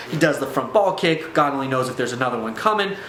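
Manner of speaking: usual speaking voice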